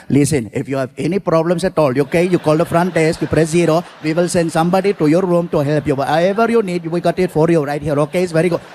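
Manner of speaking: put-on friendly clerk with an Indian accent. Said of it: "He's like".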